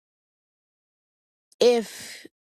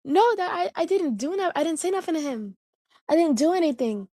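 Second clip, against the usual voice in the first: higher, pleading denial; protesting; 6 semitones higher than usual. Retold or imitated. Retelling her denial to dad, high and protesting. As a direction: insist you did nothing, voice up high. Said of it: "I say".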